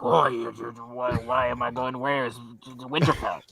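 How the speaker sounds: put-on old man voice. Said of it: "why do you talk like that".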